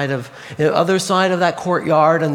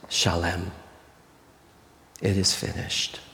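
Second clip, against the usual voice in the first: low, hushed, fading to almost no voice; very soft; 9 semitones lower, 7 dB quieter than usual. A narrator's reading. Priest's words as the sacrifice dies, very soft. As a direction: say it softly and low, as a last word. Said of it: "very softly from a distance".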